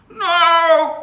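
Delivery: high, loud wailing refusal; loud protesting no. A narrator's reading. Child refusing to go to the dentist, wailing. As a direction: fight the dentist idea. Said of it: "she started to cry".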